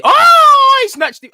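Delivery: sudden very high, very loud yelp; a gasp-shout of disbelief; shocked cry. Real, not acted. Fan watching a player snatch the ball, startled loud cry.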